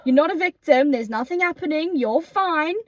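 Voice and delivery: exasperated tough love; stern scolding. Real, not acted. Scolding crying friend to calm down.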